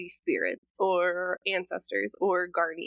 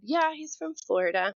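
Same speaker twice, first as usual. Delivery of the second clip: higher, lightly voiced child confirming "Florida"; little daughter; 7 semitones higher than usual. Small daughter confirming to her mother, matter-of-fact. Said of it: "And she said".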